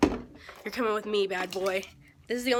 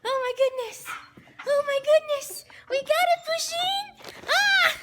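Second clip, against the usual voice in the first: high, loud gushing delight; delighted high squeal; 13 semitones higher, 5 dB louder than usual. Real, not acted. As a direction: squeal over the package. Delighted with the arrived package.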